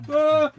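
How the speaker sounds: very high, loud shriek; startled shriek. Real, not acted. Crafter as it all falls, loud startled shriek. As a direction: shriek in alarm as it drops.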